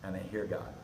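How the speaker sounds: ordinary talk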